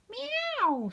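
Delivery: very high, sustained cat meow; high worried cat meow. Acted. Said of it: "said Wilbur".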